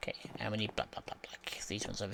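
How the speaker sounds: soft unvoiced whisper. Real, not acted. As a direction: whisper to yourself while searching. Whispering while picking blocks.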